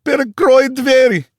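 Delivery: very high, loud terrified Russian cry; crying out in panic. A narrator's reading. Panicked man screaming in Russian, terrified.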